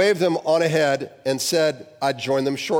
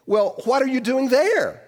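much higher, slow, laughing exclamation; exclaimed; 12 semitones higher, 4 dB louder than usual. A narrator's reading.

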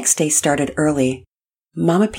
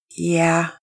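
weary. Acted. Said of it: "Pearson said wearily".

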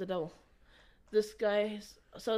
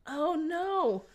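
much higher, louder cry of dismay; a high, pained oh no; alarmed oh no; 9 semitones higher, 4 dB louder than usual. Retold or imitated. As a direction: alarm as the fear comes true.

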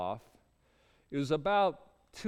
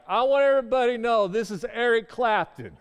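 high, booming mock announcement; loud proclamation; 8 semitones higher, 8 dB louder than usual. Homeless man presenting his friend to buddies, imitated loud proclamation.

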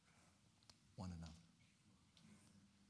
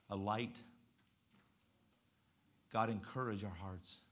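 prayer. Acted. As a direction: pray it gently and sincerely.

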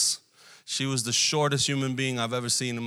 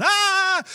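a sudden high shriek from the teacher; retold scream; 7 dB louder than usual. Teacher shrieking as the boys fight, high scream. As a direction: imitate her shrill panicked scream. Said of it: "she's screaming".

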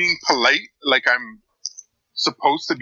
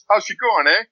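put-on Canadian accent; 8 semitones higher than usual. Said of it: "the proper Canadian greeting".

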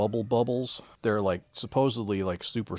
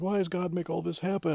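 put-on much higher wailing boy; whiny kid; 10 semitones higher than usual. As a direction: wail it like a heartbroken child. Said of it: "he's like".

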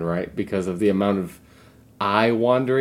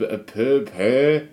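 mocking imitation of French vowels; mimicked French accent; much slower than usual. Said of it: "With the accent?".

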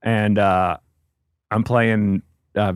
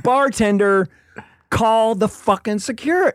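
high-pitched, drawn-out plea across the bar; exasperated calling out; 10 semitones higher, slower than usual. Retold or imitated. Robbed comedian calls bartender for security, high and exasperated. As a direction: call for help, voice pitched way up.

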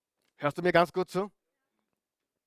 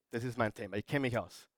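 much softer, lower, leaning in; soft emphatic repeat; 5 semitones lower, 10 dB quieter, faster than usual. Shown as a demonstration. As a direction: say it close and intimate as a confession. (leise und tief, eindringlich)

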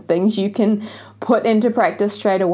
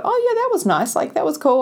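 lightly imitated breezy listener, much higher; mock casual listener; 7 semitones higher than usual. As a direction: brush it off like a careless listener. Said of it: "listen to this and go".

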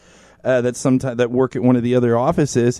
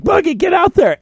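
much higher urgent shout; urgent call. Retold or imitated. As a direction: call him out to the door fast. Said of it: "they're like".

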